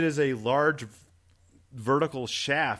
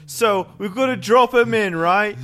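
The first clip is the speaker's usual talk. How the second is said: mock British accent; 7 semitones higher, 6 dB louder than usual. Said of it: "I'm British, not Australian".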